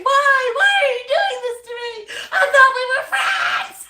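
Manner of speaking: very high, wailing mock scream, fast and laughing. Woman pleading with the rooster she thought was a friend, mock screaming. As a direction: wail it like a betrayed friend, hammed up. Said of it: "And screaming".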